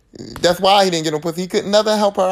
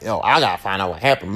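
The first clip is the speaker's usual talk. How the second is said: determined Nancy; 10 semitones lower than usual. Retold or imitated. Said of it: "Nancy is like".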